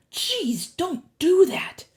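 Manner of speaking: rattled, drawn-out complaint; startled protest. A narrator's reading. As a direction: scold someone who made you jump. Startled by friend beside him.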